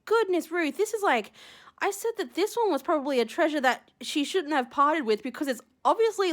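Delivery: ordinary talk, nothing marked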